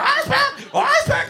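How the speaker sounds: very high, insistent squeal; screaming little girl. Acted. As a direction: squeal it over and over like a tantruming child. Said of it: "Little girl screaming in my face".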